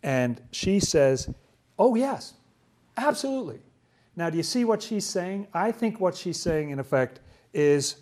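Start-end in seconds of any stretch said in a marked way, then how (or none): 1.8-3.6 high-pitched, imitated eager yes; emphatic agreement. Woman agrees with Jesus after his rebuff, imitated high agreement. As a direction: voice her agreement well up in pitch.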